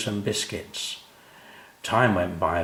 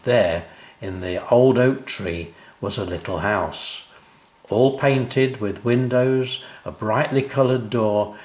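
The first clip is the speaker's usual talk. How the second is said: usual speaking voice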